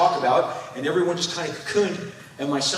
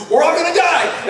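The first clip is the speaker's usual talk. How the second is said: mock panic; 10 semitones higher, 6 dB louder than usual. Panicked dad early in pandemic.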